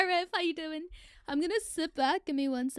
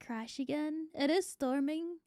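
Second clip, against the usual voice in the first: usual speaking voice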